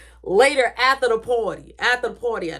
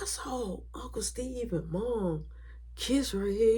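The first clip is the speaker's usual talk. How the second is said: softer, slower secret telling; hushed secret; 10 dB quieter, slower than usual. Retold or imitated. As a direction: confide it quietly.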